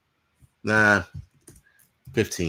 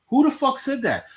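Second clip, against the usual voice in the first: outraged disbelief; 12 semitones higher than usual. Real, not acted. Ranting about Spam flavors.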